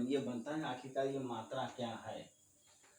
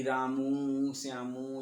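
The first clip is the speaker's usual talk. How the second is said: calling out; 7 dB louder, slower than usual. Shown as a demonstration. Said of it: "चिल्ला करके बुलाते हैं".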